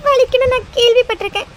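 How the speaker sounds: high, tearful wailing; crying like a child. Acted. Wife fearing the tattoo hurt husband, childlike crying. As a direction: sob out the reproach like a child. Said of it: "குழந்தை மாதிரி அழுதுட்டு இருக்க?".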